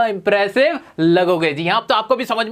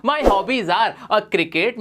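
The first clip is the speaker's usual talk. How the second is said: stretched very slowly, each word apart; slow model phrase; much slower than usual. Shown as a demonstration. Teacher modelling a new phrase for students, very slow. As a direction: draw the phrase out for the class. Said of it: "answer कर देते हो".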